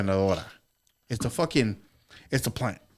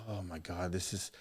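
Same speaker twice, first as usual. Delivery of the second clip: much softer, hushed and awestruck; hushed awe; 5 semitones lower, 16 dB quieter than usual. Retold or imitated. Smelling the desert breeze, hushed and awed. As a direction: breathe it out in wonder.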